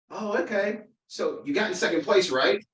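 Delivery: put-on high-pitched little-boy voice, questioning; young son. Son to dad who lost election, high child voice. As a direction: imitate a child working it out.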